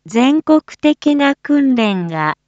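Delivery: ordinary talk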